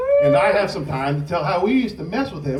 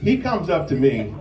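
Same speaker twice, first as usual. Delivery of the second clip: ordinary talk, nothing marked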